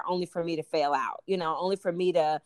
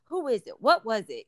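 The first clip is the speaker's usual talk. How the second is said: mildly exasperated, questioning who's to blame; a hint of frantic blame-seeking; searching frustration; 5 semitones higher, 3 dB louder than usual. Asking a guest why it happened, mildly exasperated. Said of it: "go through those emotions of".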